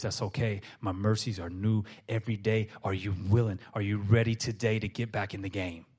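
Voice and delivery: low, soft and reassuring; God gently inviting the believer back; gentle God voice. Retold or imitated. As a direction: speak as a kind father forgiving. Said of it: "He goes".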